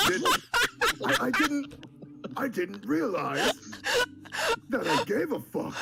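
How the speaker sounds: high, loud laughing retort. Real, not acted. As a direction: laugh through the line. Laughing mock outrage.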